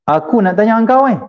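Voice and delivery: high-pitched, pushy questioning; imitating a coarse man demanding answers; rough, rude demand. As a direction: put on a rude, insistent tone.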